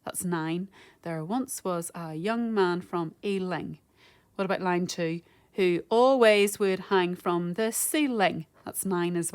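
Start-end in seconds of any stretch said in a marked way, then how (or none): 1.0-3.8 slow, separated syllables; measured, beat-by-beat reading; slow syllable count. Shown as a demonstration. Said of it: "if I say it slowly and count the syllables". 5.5-8.4 slow, each syllable distinct; measured syllable-by-syllable reading; slow syllable count. Shown as a demonstration. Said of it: "if I say it slowly and count the syllables".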